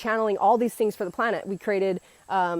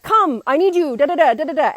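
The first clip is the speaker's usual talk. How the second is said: raised, louder, bossy mock yell; retold yelling; 5 semitones higher, 5 dB louder than usual. Patient ordering his nurse around, imitated loud yell. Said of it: "like, yelling at her".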